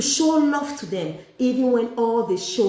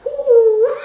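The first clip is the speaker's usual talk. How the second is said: mock gasp; 13 semitones higher, 9 dB louder than usual. Mocking imitated walk.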